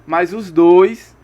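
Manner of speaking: ordinary delivery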